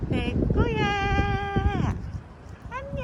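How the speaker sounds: very high, slow cooing greeting. Real, not acted. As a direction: stretch it out in a high coo. Greeting an animal sweetly.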